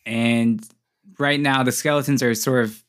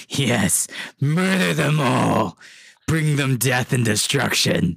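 slow, drawn-out sinister orb voice; evil orb voice; slower than usual. Acted. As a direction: intone it like an evil spirit in an orb. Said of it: "The voice in the orb goes".

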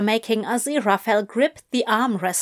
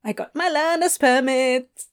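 teasing sing-song; 5 semitones higher than usual. Acted. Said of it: "in a teasing sing-song voice".

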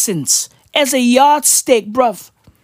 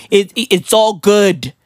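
ordinary talk, nothing marked

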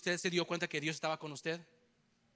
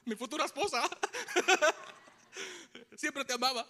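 laughing; 7 semitones higher than usual. Real, not acted. Laughing at his own story.

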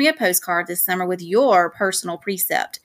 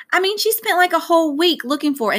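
higher-pitched, exasperated recounting; insistent amazement; 9 semitones higher than usual. A narrator's reading. Son telling dad how mom searched, insistent amazement. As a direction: press the point with rising disbelief.

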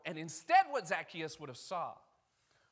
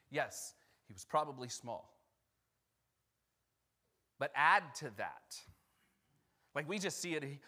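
ordinary talk

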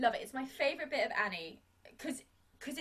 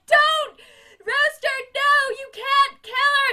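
high, loud, slow shout; frantic pleading shout; 11 semitones higher, 13 dB louder, slower than usual. Retold or imitated. Miss Hannigan rising to the occasion, screaming loud and high. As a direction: scream to save the child. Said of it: "She's like".

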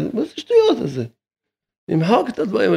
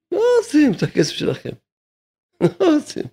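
high mocking voice; 10 semitones higher than usual. Rabbi scoffing at those arguing over two hundred shekels, high and mocking. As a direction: jump way up into a high, incredulous mocking pitch.

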